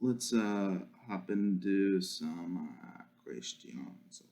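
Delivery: very slow, low, fading away; slow and halting. Pretend. Repeats the line in silly voices.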